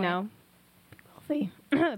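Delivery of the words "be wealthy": voice cracking out. Real, not acted. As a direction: whisper as the voice gives out. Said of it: "my voice went out".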